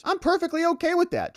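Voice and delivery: mock the judge's easy agreement; sarcastic high-pitched judge parody. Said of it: "right organizations".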